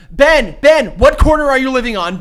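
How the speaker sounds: loud, high mocking call; taunting paparazzi. Paparazzo jeering at Ben Affleck, loud and mocking. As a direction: jeer at him by name.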